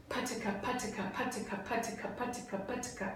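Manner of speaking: rapid pataka demo. Shown as a demonstration. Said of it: "it's gonna sound like this".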